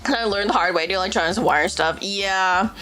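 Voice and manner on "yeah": ordinary talk, nothing marked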